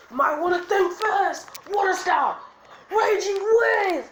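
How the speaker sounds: high, loud anime attack shout; battle cry. Acted. Acted anime attack call.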